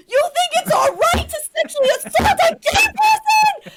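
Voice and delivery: top-of-the-lungs high scream; screaming outrage. Retold or imitated. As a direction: scream it in furious disbelief. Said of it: "I was screaming at the top of my lungs".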